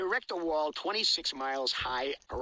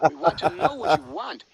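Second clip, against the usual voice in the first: Jackie Mason impression; 4 semitones higher, 17 dB louder than usual. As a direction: hammer it like a borscht-belt comic nagging. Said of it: "he sounds like Jackie Mason".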